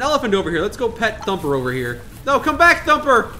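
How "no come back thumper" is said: high, loud call after someone. Acted. Explorer calls after the elephant, high and loud. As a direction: call it back, pitched up and louder.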